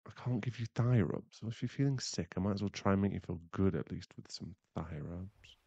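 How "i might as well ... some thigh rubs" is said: normal talking voice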